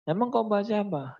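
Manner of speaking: high questioning mother; mother to child, high and curious; mother's voice. Acted. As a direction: pitch up, a mother quizzing her son.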